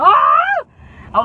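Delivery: a short high yelp of fright; panicked scream. Retold or imitated. Kids on the swerving bus scream in fright. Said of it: "we were like".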